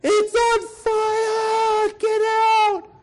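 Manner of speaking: high, loud, slow scream; screaming warning. Pretend. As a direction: yell it to save her. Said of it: "you start yelling, you start screaming".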